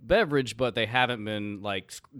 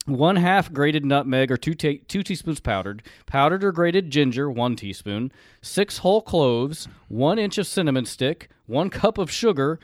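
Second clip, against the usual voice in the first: recipe reading; 4 semitones higher, 7 dB louder, slower than usual. Acted. Reads old recipe aloud.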